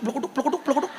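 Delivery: choppy, rising Cilacap gibberish, softly; mock Cilacap accent. Preacher teases locals about their coarser dialect, mock Cilacap accent. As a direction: parody Cilacap dialect as rapid nonsense syllables, climbing in pitch. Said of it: "Jogja agak halus, Cilacap blekuduk".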